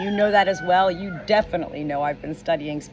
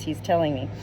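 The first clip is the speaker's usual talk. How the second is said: ordinary delivery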